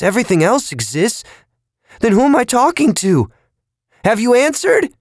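rising, frightened and lost; growing panic. A narrator's reading. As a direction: climb from unease into panic. Drugged man isolated, confused.

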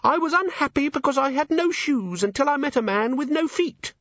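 very high, squeaky recital; pocket imp. Acted. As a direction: recite like a tiny creature in a pocket.

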